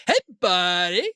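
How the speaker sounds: slow, stretched-out goofy slacker voice; Pauly Shore. Retold or imitated. Showing friend a comedian's old routine, drawn-out goofy impression. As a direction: draw the words right out, loose and silly. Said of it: "Pauly Shore".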